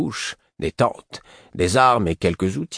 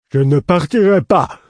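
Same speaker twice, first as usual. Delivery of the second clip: loud, high-pitched stubborn outburst; petulant shouted refusal; defiant refusal; 11 semitones higher, 9 dB louder than usual. A narrator's reading. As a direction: dig your heels in and shout it. Lourd stubbornly refuses to leave.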